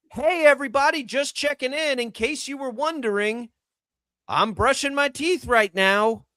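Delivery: hammed-up influencer check-in; mock influencer voice.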